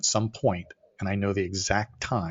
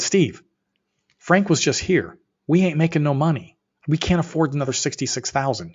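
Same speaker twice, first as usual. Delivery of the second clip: higher, louder objection to a partner; retold protest; 6 semitones higher, 4 dB louder than usual. Arguing with Steve about more spending, exasperated protest. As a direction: push back, pitched up in disbelief. Acted quarrel with business partner.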